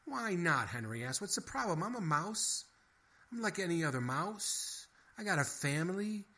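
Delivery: indignant mouse with a family to feed; pleading mouse. A narrator's reading. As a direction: beg your case like a desperate little mouse. Henry pleading.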